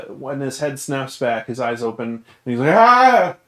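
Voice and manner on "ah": loud, high-pitched startled scream; startled waking yell. Acted. Game master as sleeper thrown to the ground, startled yell. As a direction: jolt awake with a sudden yell. Sleeper jolts awake, eyes open.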